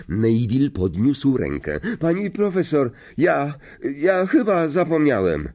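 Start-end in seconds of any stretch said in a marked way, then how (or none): 2.0-5.5 high, hesitant stammer; nervous pupil. A narrator's reading. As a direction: confess haltingly to the teacher, pitched high. Nervous pupil admits forgetting. (niepewnie, jąkając się)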